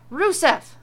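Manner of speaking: loud call across the yard; yelled. A narrator's reading. Wife calling husband out at the woodpile, loud yell. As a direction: shout his name out to the backyard. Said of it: "she yelled out to him".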